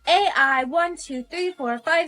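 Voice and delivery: even, mechanical recital of digits; robot reciting code. Acted. Robot gives human its code name, mechanical recital. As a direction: count it off like a machine.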